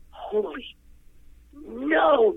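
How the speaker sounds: high, amazed gasp of disbelief; as if you can't believe your ears; stunned disbelief. Retold or imitated. Singer hearing her own song in the studio, stunned and high.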